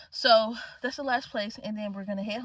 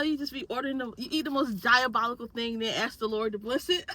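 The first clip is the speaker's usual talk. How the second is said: ordinary talk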